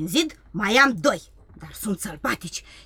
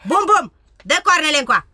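louder, higher order to an animal; bossy command; 5 semitones higher, 6 dB louder than usual. Acted. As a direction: call the reindeer over sharply. Ordering the reindeer. (poruncă răstită către ren)

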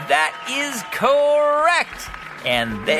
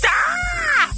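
sudden high yelp of fright; startled yelp. Real, not acted. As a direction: jump and yelp in shock. Sees snake in library.

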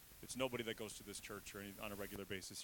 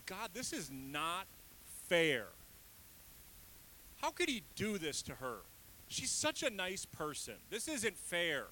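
higher, louder indignant plea; upset venting; 6 semitones higher, 5 dB louder than usual. Retold or imitated. Pastor venting to God about a friend's mistreatment, upset and louder. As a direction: vent the unfairness to God, rising. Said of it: "I was so just upset".

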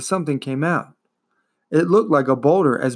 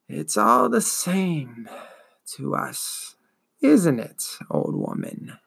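slow, dragging, wheezing ancient voice; wheezing old turtle; 3 semitones higher, 3 dB quieter, slower than usual. Acted. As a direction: drawl it out like a weary old beast. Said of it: "Morla replied".

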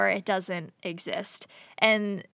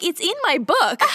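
put-on laughing kid voice; 7 semitones higher, 3 dB louder than usual. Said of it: "And he's like".

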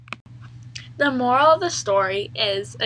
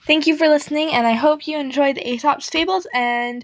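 cheerful sign-off; 4 semitones higher, 7 dB louder than usual. Real, not acted. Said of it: "sign-off to listeners".

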